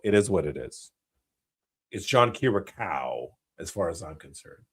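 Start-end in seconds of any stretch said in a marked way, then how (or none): 2.1-3.3 John Kira Cow, slow, ending in "ow"; pronunciation Kira Cow. Shown as a demonstration. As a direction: slowly land Kira Cow on an "ow". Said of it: "I like the way that it sounds".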